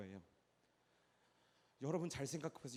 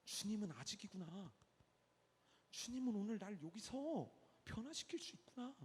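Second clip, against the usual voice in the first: ordinary talk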